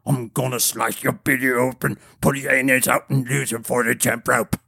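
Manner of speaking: slurred giant's threat, understated fury; furious giant. A narrator's reading. Giant threatens Niles in the fight, furious giant. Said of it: "being in such a furious state".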